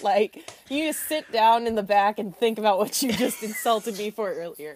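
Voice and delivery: ordinary talk, nothing marked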